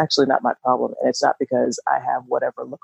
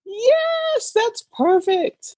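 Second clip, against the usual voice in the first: delighted squeal; much slower than usual. Real, not acted. Delighted by cohost's summary.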